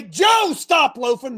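high loud shout of a name; single Joe out; calling out a name. Retold or imitated. Coach calling out Joe for loafing, loud. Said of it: "If I say".

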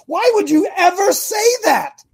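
high-pitched, exasperated disbelief; incredulous challenge. Real, not acted. Preacher challenging people who predict divorce, incredulous challenge. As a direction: pitch the whole question way up, baffled. Challenges the negative remark just acted.

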